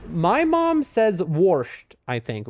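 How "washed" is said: "warshed" said slowly with an R sound before the sh; imitating mom saying "warshed", slow and drawn. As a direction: drag out "warshed" like mom, R slipped in. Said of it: "with an R".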